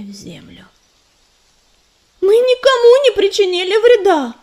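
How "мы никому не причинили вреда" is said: high, loud protest. A narrator's reading. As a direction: shout it in defence. Leafpool defends herself to mentor.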